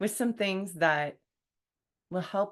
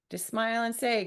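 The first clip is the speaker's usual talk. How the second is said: ordinary talk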